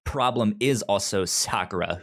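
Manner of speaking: ordinary delivery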